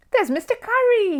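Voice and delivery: high, eager and bright; excitedly. A narrator's reading. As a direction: spot a friend and point, thrilled. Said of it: "he said excitedly".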